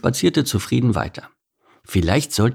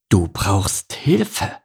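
very slow, dawning realization; delighted deduction; much slower than usual. Acted. Beaver spots someone to rescue, slowly delighted. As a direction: stretch out each word as it dawns on you. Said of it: "kombinierte Bert erfreut".

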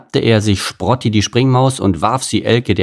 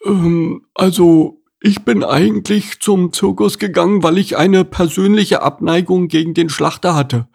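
much higher timid piggy voice; pot-bellied pig confessing; hesitant pig; 9 semitones higher than usual. Acted. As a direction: pipe up shyly. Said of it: "meldete sich Hugo".

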